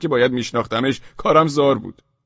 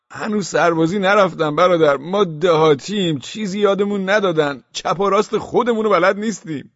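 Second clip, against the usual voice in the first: higher, louder pleading villager; naive village pleading; 7 semitones higher, 6 dB louder than usual. Acted. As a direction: beg them as a simple village boy. Pleading with the club-wielding mob.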